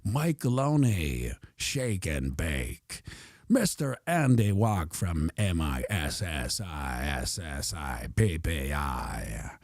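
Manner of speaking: low, slow movie-trailer read. Acted. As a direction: read names like a blockbuster trailer. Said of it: "all of it in the trailer voice".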